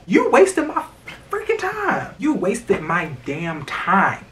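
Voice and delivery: high-pitched, acted-out exasperation, dragging the last words; exasperated outburst. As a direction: play fed up, starting high and slowing down. Acted complaint to a date.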